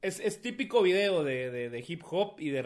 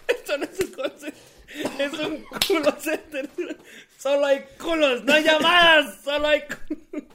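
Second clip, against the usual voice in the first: higher, words bursting with laughter; laughing punchline; 10 semitones higher, 7 dB louder than usual. Real, not acted. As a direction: deliver the joke cracking up. Remata el chiste riendo. (rematando el chiste entre risas)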